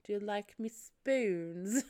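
slow, creepy drawn-out character voice; Salad Fingers. Retold or imitated. Turning round with a rusty kettle, creepy impression. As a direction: linger creepily over each word. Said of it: "She almost sounds like Salad Fingers".

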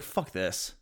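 a flat under-the-breath bail-out; giving up. Pretend. Comic admitting he'd really just mutter and walk off. Real reaction to fire.

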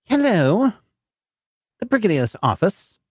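high prim secretary voice; woman's voice. Acted. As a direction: answer like a switchboard secretary. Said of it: "a woman's voice".

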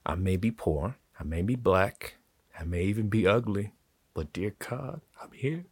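softly spoken to the person who hurt; quiet, as if to that person. Shown as a demonstration. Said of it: "Talk to that person".

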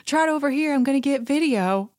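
high, eager stage-mom calling. Retold or imitated. Assistant filming Sutton's trot, high stage-mom cheer. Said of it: "stage mom energy".

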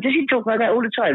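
high, puzzled American-star voice; high mock John Voight. John Voight asking Martin about Joe's voice, high and baffled. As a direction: raise the pitch and sound baffled by the accent. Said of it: "he went".

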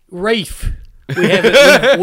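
ordinary delivery

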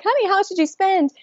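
high sweet but pointed ask; pointed question. Retold or imitated. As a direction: press your husband with a high sweet voice. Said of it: "I'm like".

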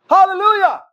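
high, loud burst of hallelujah; shouted hallelujah. Retold or imitated. Cook shouts hallelujah in the middle of the rush, loud cry. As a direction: blast it out like a cheer. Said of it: "screaming praises to God".

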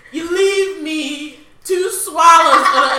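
high, loud, slow singsong nagging; teasing singsong of a mother's gripe; sing-song mother complaint. Retold or imitated. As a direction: sing the complaint like an annoyed mom.

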